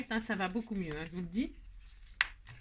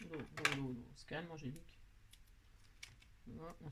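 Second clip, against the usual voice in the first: se calmer soi-même; 5 semitones lower, 15 dB quieter than usual. Real, not acted. Se calme elle-même, coupe trop gros.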